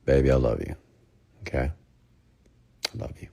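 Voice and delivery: soft, tender and calm; gentle breakup demo. Shown as a demonstration. Coaching young men, calm tender breakup line. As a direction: say it gently, letting her down easy. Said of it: "Do it just like this".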